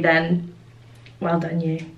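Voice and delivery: ordinary talk, nothing marked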